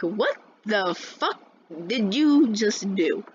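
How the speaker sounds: slow, drawn-out disbelief; incredulous. Retold or imitated. Student to teacher who stayed silent, incredulous. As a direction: stretch the words in mock outrage. Acted outrage at teacher doing nothing.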